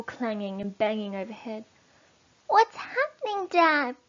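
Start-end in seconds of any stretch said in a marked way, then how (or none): 2.5-4.0 put-on high, frightened child's cry; little foxes calling to their father in fear; small foxes crying out to their dad underground; cried out. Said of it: "cried the small foxes".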